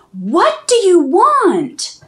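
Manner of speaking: loud, high, slow demanding yell. Acted. Girl shouting at the witch to protect her brother. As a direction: stand your ground and shout it. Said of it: "Redmond yelled".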